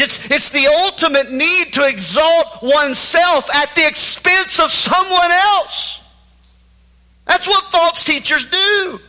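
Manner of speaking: loud, high fiery preaching; preaching at full force. Acted.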